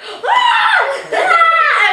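high, loud mock screaming on the ride; hammed-up terrified shriek; imitated scream. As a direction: scream like a scared friend on a roller coaster. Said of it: "she's screaming".

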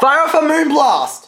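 high, slow, drawn-out command; rousing battle call. Real, not acted. Player ordering a Moonblast, in a big excited call. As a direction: call the attack out high and stretched.

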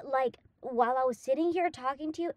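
ordinary talk

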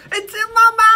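shrill-high mock gag voice, loud; mock disgust. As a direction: squeal as if vomit is in your mouth. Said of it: "He's like".

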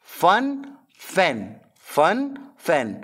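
Fun with an uh sound, fan with an open a; vowel contrast drill. Shown as a demonstration. Teacher drilling Fun and fan aloud. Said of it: "उच्चारण करना सिखा रहा है".

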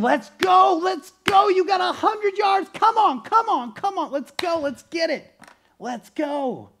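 high, loud yelling encouragement; coach shouting. Retold or imitated. Coach running beside him in the last hundred yards, shouting. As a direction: drive the runner home, yelling. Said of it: "he was saying".

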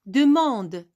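"Demande" said firmly, the "an" nasal opened clearly; clear sound demo. Shown as a demonstration. Teacher showing pupils how "Demande" sounds. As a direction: stress the open "an" in "Demande". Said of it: "Comme ça se prononce".

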